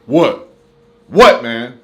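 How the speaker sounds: egging on the mad case manager; mock challenge. Staring down the case manager, loud challenge.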